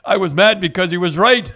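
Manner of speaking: real laugh breaking through the line. Real, not acted. Laughing at his own story.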